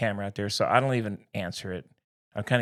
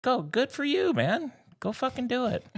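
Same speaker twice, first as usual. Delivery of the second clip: sarcastic mock cheer; 13 semitones higher, 4 dB quieter than usual. Sarcastic retold reply, very high.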